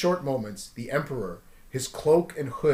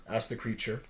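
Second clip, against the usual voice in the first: usual speaking voice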